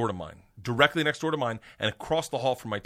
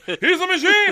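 very high, loud laughing cheer; 11 dB louder than usual. Retold or imitated. Gangsters hailing their new friend, high laughing cheer. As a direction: crow it through laughter. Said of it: "And they're like".

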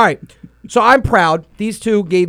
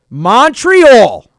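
excited reveal; 4 semitones higher, 8 dB louder than usual. Real, not acted. Big announcement reveal.

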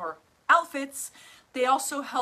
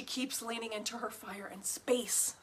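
whispering; 8 dB quieter than usual. Real, not acted. Confiding a client's success.

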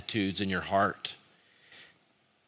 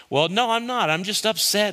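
high-pitched acted exasperation; upset, not yet calm; 10 semitones higher, 4 dB louder than usual. Upset speaker defending themselves to a friend, acted exasperation. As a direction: protest in a heated, raised pitch. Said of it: "then they calm it down".

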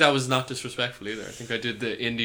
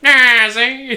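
much higher and louder mock noir gangster; Chappelle's gangster voice; 11 semitones higher, 15 dB louder than usual. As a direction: burst into the hammed-up tough guy, see. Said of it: "He just breaks into the voice".

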